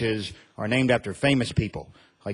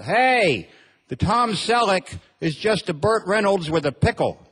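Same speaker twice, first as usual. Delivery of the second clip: put-on old man yelling; 6 semitones higher, slower than usual. Elderly guy in back of deli yells to the room, mock holler. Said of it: "I suddenly hear him yell out".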